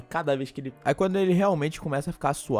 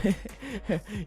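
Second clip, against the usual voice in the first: put-on girlish giggle; 6 semitones higher, 5 dB quieter than usual. Girl laughs off the warning.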